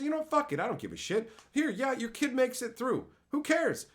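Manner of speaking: high-pitched dismissive giving up; mock exasperated teacher. Teacher passing a kid through, mock exasperated.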